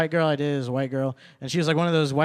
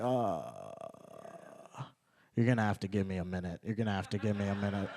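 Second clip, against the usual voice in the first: muttered stall; 6 semitones lower, 10 dB quieter than usual. Retold or imitated. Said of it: "And I was like".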